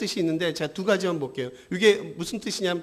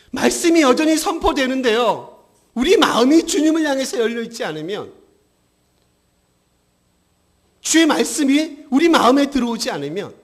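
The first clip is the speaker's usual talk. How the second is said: loud, raised-pitch sermon delivery; full-force preaching; 7 semitones higher, 8 dB louder than usual. Acted. Preacher warns hearts closed to the Word, full-force preaching. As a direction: exhort the congregation forcefully.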